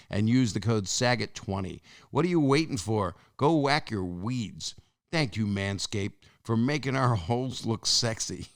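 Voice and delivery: upbeat sponsor read with the promo code; podcast ad voice, pushing the code. Acted. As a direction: sell it like a radio spot. Host reads sponsor ad.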